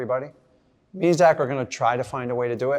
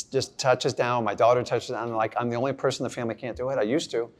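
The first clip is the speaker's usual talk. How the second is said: normal talking voice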